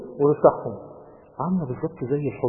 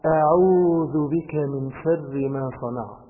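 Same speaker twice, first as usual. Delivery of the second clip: very slow, louder on أبوء لك; slow and deliberate; 3 dB louder, much slower than usual. Shown as a demonstration. Preacher pointing the youths to this part, slowly. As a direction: linger over each word. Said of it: "بص الحتة دي بقى".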